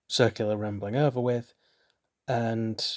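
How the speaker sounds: normal talking voice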